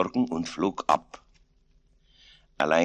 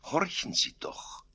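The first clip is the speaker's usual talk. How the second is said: soft, slow, higher-pitched hush; hushed urging; 12 semitones higher, 7 dB quieter, slower than usual. A narrator's reading. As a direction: hush them so they listen. Gott lässt Petrus lauschen. (leise zum Horchen mahnend)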